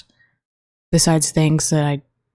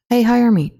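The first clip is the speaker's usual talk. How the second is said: mock applicant; 7 semitones higher, 3 dB louder than usual. Imagined job applicant line.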